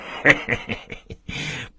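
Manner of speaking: long self-satisfied laugh; gloating chuckle; smug laugh. Acted. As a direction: cackle like a bully who has won. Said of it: "陶三不住的哈哈大笑".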